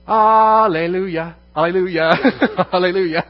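loud, high shouts of hallelujah; preacher's ringing hallelujahs; exultant praise. Real, not acted. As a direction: cry it out in jubilant praise. Preacher exulting over freedom from law.

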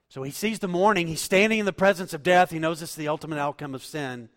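loud, high impassioned preaching; fiery sermon climax; preaching at full force. Acted.

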